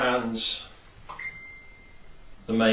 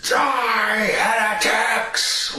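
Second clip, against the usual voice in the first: much higher, loud, slow battle shout; war cry; 11 semitones higher, 4 dB louder, much slower than usual. Retold or imitated. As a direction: roar it at the enemy horde. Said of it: "it all just goes".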